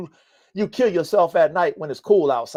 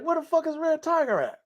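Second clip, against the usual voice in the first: high, softer searching call; searching for the dog; 10 semitones higher, 6 dB quieter than usual. Retold or imitated. Looking around outside for Red Tiger, a high softer call. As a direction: call out for the missing dog, worried. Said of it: "I'm like".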